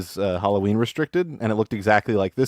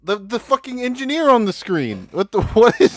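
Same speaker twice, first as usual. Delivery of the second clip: stunned disbelief; 13 semitones higher, 4 dB louder than usual. Real, not acted. Stunned by image on screen.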